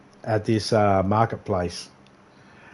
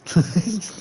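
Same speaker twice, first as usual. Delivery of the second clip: short, high mimicked giggle; high giggle after the punchline; imitated giggle; 8 semitones higher than usual. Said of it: "And then giggle".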